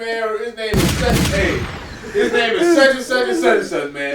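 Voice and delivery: high, loud laughing words. Real, not acted. Retelling name to nurse, laughing loudly. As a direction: laugh through the quote.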